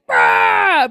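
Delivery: deep mock yell of horror; a low, tough man's mock scream. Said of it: "they're like".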